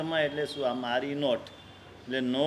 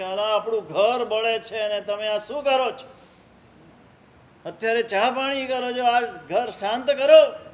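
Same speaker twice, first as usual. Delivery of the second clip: angry son; 6 semitones higher, 5 dB louder than usual. Retold or imitated. Son scolds family drinking tea.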